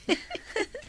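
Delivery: a short, high, soft laugh; a quick, quiet laugh up high. Acted. Mother laughs about missing someone.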